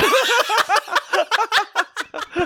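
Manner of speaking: long high laughter falling off; big laugh. Real, not acted. Telling how the victim really walked out, laughing hard. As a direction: dissolve into laughter. Laughing at prank going wrong.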